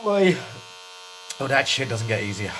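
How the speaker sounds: ordinary talk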